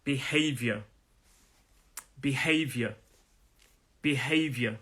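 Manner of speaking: "behavior" ending in one syllable, vyuh; careful "behavior", the ending run together as vyuh; merged last syllable. Shown as a demonstration. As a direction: say "behavior" as bi-HAY-vyuh, falling. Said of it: "kind of one syllable there".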